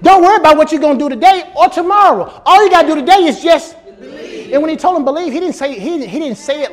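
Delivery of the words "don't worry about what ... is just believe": preaching climax. Acted. Preacher voicing Paul to the jailer, high fervent. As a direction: preach it home.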